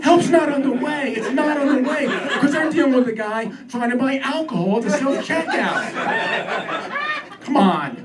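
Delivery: high-pitched exasperated rant; worked up. Acted. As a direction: rant as the frustration boils over. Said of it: "I'm getting worked up".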